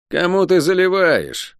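high, drawn-out indignant challenge. A narrator's reading. Шен disputing the cursed woman's excuse, indignant. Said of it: "возмутился Шен".

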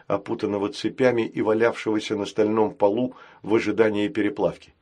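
plain reading voice

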